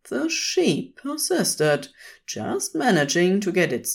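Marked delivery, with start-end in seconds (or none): none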